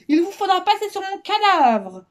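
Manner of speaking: high, loud, tremulous defiance; quavering heroic stand, unconvincing; trembling voice. A narrator's reading. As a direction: defy them in a high, frightened voice. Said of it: "d'une voix tremblante".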